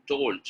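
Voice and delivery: "Told" with a released final d; clearly sounded ending. Shown as a demonstration. Teacher correcting a mistake, "Told" with the ending sounded. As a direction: land firmly on the last consonant of "Told". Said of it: "you need to make the sound".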